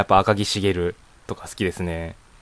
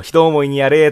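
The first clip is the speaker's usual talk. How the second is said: anime character's line, higher and louder; Shiro impression; 5 semitones higher, 6 dB louder than usual. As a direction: act out a defeated character, raised and loud. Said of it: "シロがやられた時に言うセリフ". (高めで大きめの声真似)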